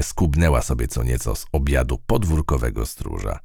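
ordinary delivery, nothing marked

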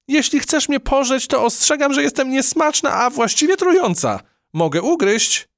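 very high, loud, frantic warning; a tiny mouse faking menace in panic; scared bluster. Acted. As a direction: bluff bravely while terrified. Trapped mouse threatens unseen captor.

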